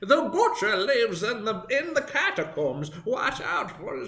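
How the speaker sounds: put-on a doddering old man in the kitchen; Deckard Cain voice. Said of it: "the old man voice".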